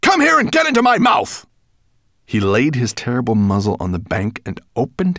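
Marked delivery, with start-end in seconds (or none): 0.0-1.4 high, loud dragon order; commanding dragon. Acted.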